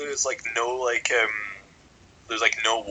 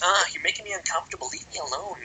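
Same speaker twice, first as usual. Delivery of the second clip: higher-pitched imitation of a complaining ex; mock complaint; 7 semitones higher than usual. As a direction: lightly mimic an upset ex, pitched up. Acting out the ex's complaint.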